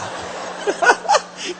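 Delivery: high, airy laugh; laughter. Real, not acted. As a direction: laugh at your own wordplay. Laughs at his own wordplay.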